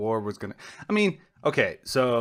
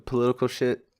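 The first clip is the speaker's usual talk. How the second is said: ordinary talk, nothing marked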